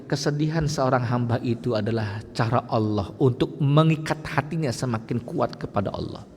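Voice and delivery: ordinary delivery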